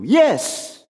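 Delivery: high, emphatic yes; agreeing tempter. Acted. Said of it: "first agreeing with you".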